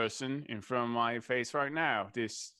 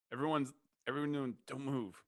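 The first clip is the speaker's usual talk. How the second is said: ordinary talk, nothing marked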